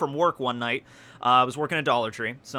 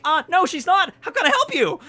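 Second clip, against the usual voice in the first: very high, louder nervous voice; nervous squeak; 5 dB louder than usual. Retold or imitated. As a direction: squeak politely in panic. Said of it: "So I was extraordinarily nervous".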